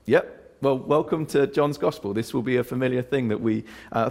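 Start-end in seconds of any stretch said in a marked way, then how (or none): 0.1-2.1 breezy reply. Acted. Imagined reply to a puzzled reader.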